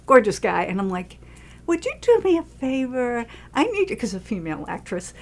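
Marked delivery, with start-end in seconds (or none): 1.7-4.0 coaxing request. Retold or imitated. Texting a tall friend for a favor, coaxing sweetly. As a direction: ask nicely, drawing it out.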